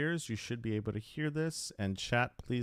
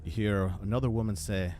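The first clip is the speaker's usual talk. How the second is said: ordinary delivery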